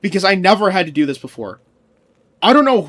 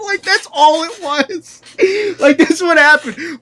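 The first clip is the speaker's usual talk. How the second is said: laughing; 10 semitones higher, 4 dB louder than usual. Real, not acted. Streamer admitting his petty plan, higher laughing voice. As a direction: still giggling as you speak.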